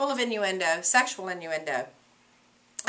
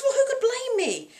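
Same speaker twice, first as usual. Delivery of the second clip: much higher, protesting voice; hammed-up defensive Macbeth; 3 dB louder than usual. Teacher voicing Macbeth justifying the killings, high and protesting. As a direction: plead innocence with a show.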